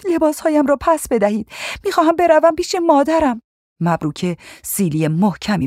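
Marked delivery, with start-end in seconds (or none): 0.1-3.4 put-on very high, desperate girlish plea; pleading girl. A narrator's reading. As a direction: beg for it in a high young voice. Captive girl begs for mother.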